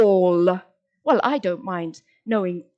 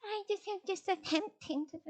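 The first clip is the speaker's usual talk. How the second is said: weak mock voice; 8 semitones higher, 12 dB quieter than usual. Weak deflated voice.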